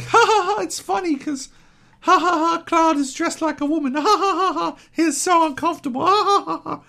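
high-pitched, forced hilarity; exaggerated high giggly mockery; mocking fake laughter. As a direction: pretend a lame joke is hysterical. Mocking dated gag with fake laughter.

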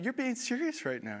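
high-pitched disbelief; mock stunned reaction; incredulous. Incredulous retort.

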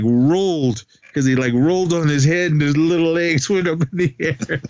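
ordinary talk, nothing marked

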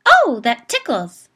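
high, slow, loud squeaky toy giggle; a triggered toy bear squealing with delight; giggly toy voice. Acted. A talking toy bear giggling its line, high and loud. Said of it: "a high-pitched voice giggle and say".

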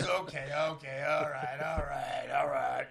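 drawn-out, repeated grudging agreement; imitating friend relenting. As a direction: lightly impersonate a big guy conceding. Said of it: "He started, he started laughing".

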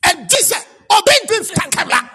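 fiery Pentecostal deliverance shout; preaching at full force. Acted. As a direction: rebuke the devil at full volume.

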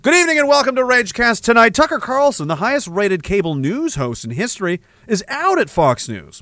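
lightly voiced news anchor; a hint of an anchorman read; Tucker Carlson. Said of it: "do it like Tucker Carlson".

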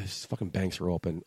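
usual speaking voice

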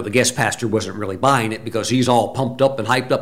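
normal talking voice

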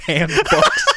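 laughing through the words, high; giggling it out. Real, not acted. Laughing repeat.

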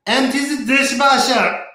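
high, loud nagging husband call; mock Toto nagging. Podcaster mimics Toto ordering his wife, high and loud. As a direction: call out to her like an impatient husband.

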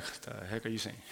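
low, soft mock bewildered voice; a doubter muttering in the pews; imitating a confused listener. Preacher mocks a puzzled congregant, soft and low. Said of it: "Some of you are gonna like".

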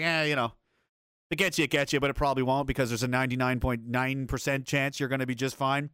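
usual speaking voice

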